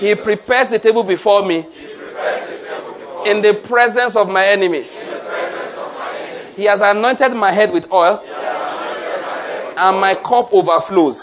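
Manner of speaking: preaching a psalm; psalm declaration. Acted. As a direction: declare each line to the church.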